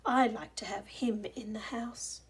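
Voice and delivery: soft whispered line, quiet as a mouse. A narrator's reading. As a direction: hush it like a mouse is listening. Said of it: "quiet as a mouse".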